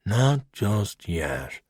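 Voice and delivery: slow. Acted. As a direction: draw each word out, thinking. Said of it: "he said slowly".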